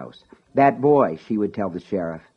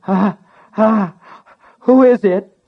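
high, loud frightened shriek; shrieking little voice; 11 semitones higher, 8 dB louder than usual. Acted. Terrified boy shrieks down to his brother. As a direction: panic rising as he squeaks it out. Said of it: "in a shrieking little voice".

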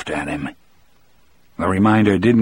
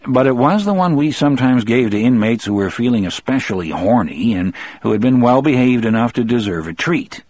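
ordinary delivery

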